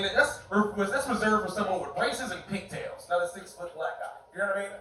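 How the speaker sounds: ordinary talk, nothing marked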